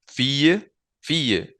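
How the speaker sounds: fille and filles said alike, fiye; same sound demo. Shown as a demonstration. Teacher showing fille sounds the same in plural. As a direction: fille with no S heard. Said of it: "yo no cambié la pronunciación".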